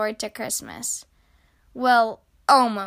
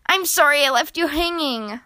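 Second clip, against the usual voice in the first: ordinary delivery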